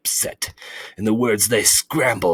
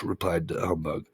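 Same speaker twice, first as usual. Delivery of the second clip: plain reading voice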